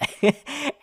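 high burst of laughter. Real, not acted. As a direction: laugh at yourself. Laughs at himself.

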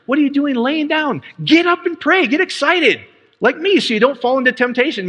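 high-pitched, acted scolding turning to urging; imitated wake-up call, voice pitched well up; urgent rousing exhortation. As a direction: rouse sleepers with excited insistence. Jesus rousing sleeping disciples, acted.

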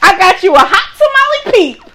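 high, loud, excited reveal; gleeful announcement. Real, not acted. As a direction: announce it with a big excited shout.

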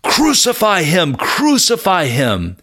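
high, drawn-out chant of an angry mob, half-volume; restrained mock yell, pitched high and stretched out; mock crowd yell. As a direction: imitate a crowd calling out, without full shouting. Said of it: "a crowd yell out".

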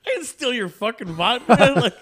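high, loud protest through laughter; laughing indignation. Retold or imitated. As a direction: deny it, half laughing, half outraged. His indignant reply, laughing.

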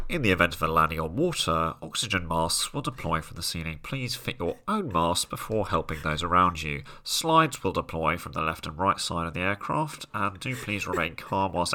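formal cabin safety briefing; safety announcement. Retold or imitated. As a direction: recite it like a flight attendant's safety drill. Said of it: "safety announcements before flight where it's like".

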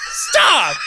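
shrieking through laughter; laughing shout. Real, not acted. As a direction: yell it mid laugh fit. Crying-laughing outburst.

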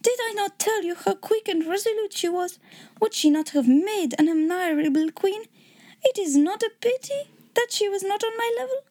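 put-on lilting German accent, regretful king. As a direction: admire her in a theatrical German accent. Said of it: "I hope you liked my German accent".

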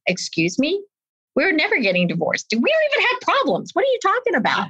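mock-baffled husband, climbing high and quick; incredulous husband. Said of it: "my husband was like".

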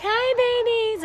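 high squeaky greeting; high-pitched baby talk. Retold or imitated. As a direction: chirp it up high. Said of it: "I'm always like".

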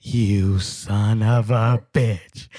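drawn-out, very slow menacing growl; Farley's slow fury. Retold or imitated. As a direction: drag each word out in slow rage. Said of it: "and he's like".

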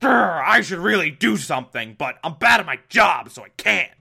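hammed-up blustering official; mock growl, then helpless bluster; Commissioner Gordon. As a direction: bluster like an inept police chief. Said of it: "Commissioner Gordon's like".

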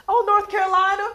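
high bright answer; proud southerner; cheerful local answer. Retold or imitated.

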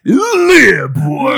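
high, loud announcer show name; radio host voice. Pretend.

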